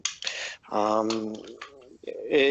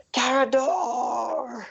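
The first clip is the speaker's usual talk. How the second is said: high, long-drawn mock battle cry; shouted name; 13 semitones higher, much slower than usual. Bullywug rushes in and shouts out, high drawn-out cry. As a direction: stretch the name out in a high wail. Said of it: "he shouts out".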